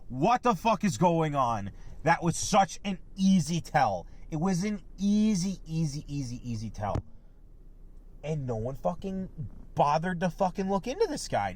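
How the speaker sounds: ordinary talk, nothing marked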